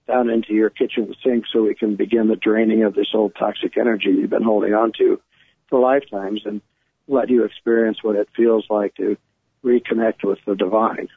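ordinary delivery

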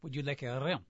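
patient faux French, said quietly; French accent, hushed. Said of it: "Pink Panther movies".

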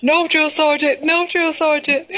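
high, slow recruit response; trainee shouting back. Retold or imitated. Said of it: "they have to say".

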